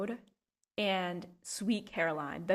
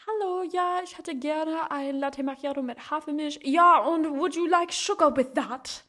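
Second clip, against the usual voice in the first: higher-pitched bilingual exchange act; acted coffee order; 5 semitones higher, 4 dB louder than usual. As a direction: act out a German café order switching to English. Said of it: "I'll be like".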